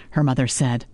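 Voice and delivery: ordinary delivery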